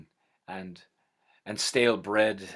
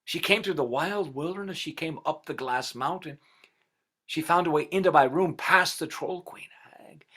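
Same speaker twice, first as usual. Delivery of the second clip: hushed awe for his beloved; tender; 6 semitones higher than usual. A narrator's reading. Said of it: "and he said".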